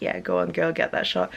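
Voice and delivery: low whispered cheer, playful; whispered hype. Retold or imitated. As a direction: hype her up under your breath. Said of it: "and I was like".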